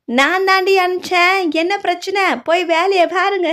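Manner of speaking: put-on an old lady waving the fuss away; bossy old grandmother. Grandmother tells the family she sent him, dismissive old lady voice.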